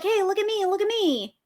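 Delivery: high, sing-out plea for notice; attention seeker. Acted. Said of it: "they're like".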